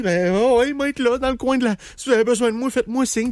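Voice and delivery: voix haut perchée de mari complaisant; cocu empressé. Acted. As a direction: offre tes services en mari soumis, bien aigu. Joue le cocu trop serviable. (imitation aiguë d'un cocu tout accommodant)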